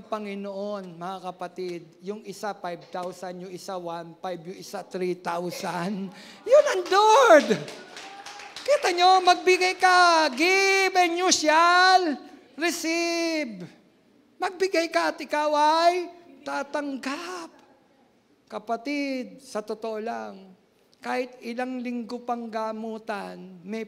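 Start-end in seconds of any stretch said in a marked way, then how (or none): 6.4-17.5 loud, slow, high preacher's cry; preaching at full force. Real, not acted. Pastor crying out to the congregation, loud and slow. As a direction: proclaim it to the whole church.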